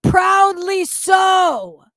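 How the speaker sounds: loud, furious, last words slowed; shouting an accusation of calling CPS; outraged shout. Real, not acted. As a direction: bellow the accusation in disbelief.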